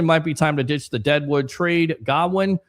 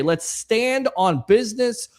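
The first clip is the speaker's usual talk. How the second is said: slow, drawn-out slang with a raised pitch; kids' slang; 8 semitones higher, slower than usual. Retold or imitated. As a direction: say it like the kids do, a bit higher. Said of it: "As the kids would say".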